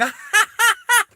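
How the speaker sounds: high mock villain laugh. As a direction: cackle like the gloating villain. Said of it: "she starts laughing".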